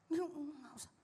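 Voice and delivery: hushed, mumbled brush-off; reluctant refusal. Acted. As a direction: softly imitate an awkward husband declining. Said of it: "Susah mau gandeng". (menolak dengan suara pelan)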